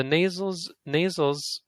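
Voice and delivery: stressed key term; emphasised term. Shown as a demonstration. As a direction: lift and stretch "nasals". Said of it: "the final group is nasals".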